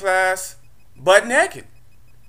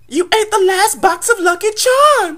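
mock girl's voice; 3 dB louder than usual. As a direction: squeak it out like the girl telling on him. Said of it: "She was like".

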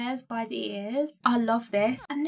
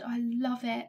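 normal talking voice

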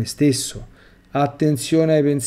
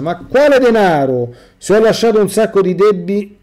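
high, loud, defiant outcry; a loud, indignant protest hurled at the judging voice; indignant shout; 7 semitones higher, 7 dB louder than usual. Retold or imitated. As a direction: shout it back in self-defence. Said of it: "urlai a mia difesa".

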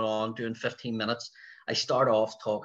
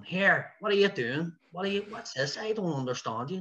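higher-pitched mock heckler; imitated heckling; 8 semitones higher than usual. Puzzled audience interrupting an Irish set, imitated heckling. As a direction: act out a baffled audience member.

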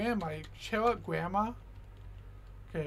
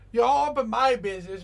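exasperated loud complaint; 3 semitones higher, 7 dB louder than usual. Real, not acted. Complaining at Granny.

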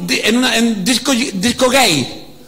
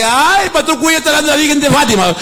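mock critic; 7 semitones higher, 3 dB louder, faster than usual. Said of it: "nos falta el que".

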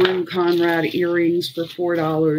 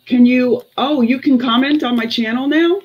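surprised; 6 semitones higher, 6 dB louder than usual. Real, not acted. Surprised by viewer comment.

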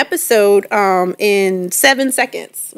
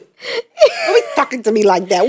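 mock scolding; 3 dB louder than usual. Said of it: "I'm like".

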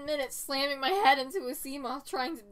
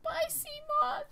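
high, slow sing-song farewell; much higher, drawn-out tender goodbye; slower than usual. Real, not acted. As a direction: coo goodbye like to a pet. Farewell to abandoned ship.

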